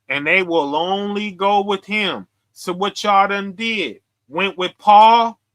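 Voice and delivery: ordinary delivery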